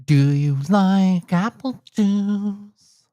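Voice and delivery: high, very slow, drawn-out character voice; put-on character voice. Shown as a demonstration. Rehearsing a line for his recording, slow high voice. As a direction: stretch every word in a high silly voice.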